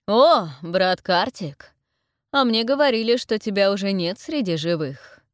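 booming mock greeting from above; loud, projected voice of the leader; loud address. A narrator's reading. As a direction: call down loudly to an old brother. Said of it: "Громко звучит тот же голос".

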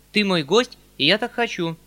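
loud, high insistence; insistent shout. A narrator's reading. Uncle loudly insists his nephew is his guest. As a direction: demand it warmly but loud. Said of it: "закричал он".

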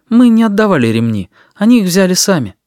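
polite, high-pitched self-defence. A narrator's reading. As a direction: put them right politely, high and firm. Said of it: "вежливо сказал Сенцов". (вежливое оправдание высоким голосом)

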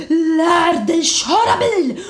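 high, loud, drawn-out angry yell; screaming. Retold or imitated. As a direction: mimic a furious man, stretching every word. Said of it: "han bara skriker".

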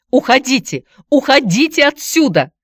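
high, loud cries to leave; shouting. A narrator's reading. Aunt drives visitor out while holding nephew, shouting. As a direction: order someone out at a shout. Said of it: "кричала она мне".

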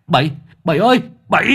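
high urgent call; shouting after someone. Acted. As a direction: yell the name while giving chase. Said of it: "đuổi theo la hét".

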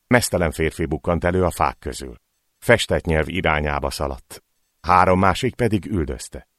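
usual speaking voice

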